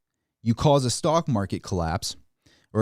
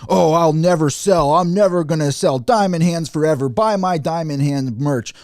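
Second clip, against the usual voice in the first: Meet Kevin; 5 semitones higher, 6 dB louder than usual. Retold or imitated. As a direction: hype it up like a YouTuber selling merch. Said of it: "Meet Kevin breaking the internet".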